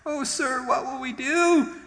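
very high, hammed-up wail; panicked young man. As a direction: squeal in comic panic to your master. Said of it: "the young man cried to Elisha".

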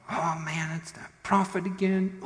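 high, mock-exasperated complaint; exasperated groaning. King exasperated at the prophet.

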